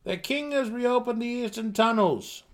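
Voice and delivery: high, loud, formal herald voice; booming messenger. Acted. A herald announcing the king's news to Drizzt, loud and high. Character voice in read story.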